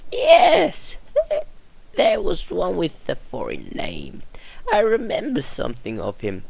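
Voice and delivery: put-on a frail old woman remembering; old nurse voice.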